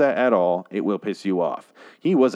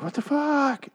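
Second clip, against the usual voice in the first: mock disbelief; slower than usual. Woman left behind as the man runs off, mock disbelief. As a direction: stretch it out in a squeaky put-on pitch.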